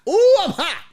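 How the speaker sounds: loud, very high-pitched exclamation; fuming outburst. Retold or imitated. Passenger fuming on a bench, loud outburst. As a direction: let the heat burst out in a high yelp.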